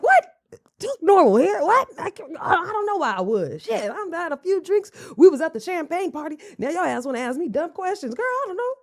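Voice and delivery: high-pitched, flustered imitation of a cornered boyfriend; mock defensive man. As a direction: stammer out excuses with a laugh in the voice. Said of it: "And he like".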